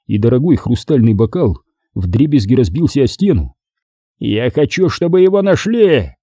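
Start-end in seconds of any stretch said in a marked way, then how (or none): 4.2-6.1 angry order climbing in pitch; angry growl. A narrator's reading. Boss orders his men to find him, growling. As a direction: demand it, rising high. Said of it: "прорычал босс".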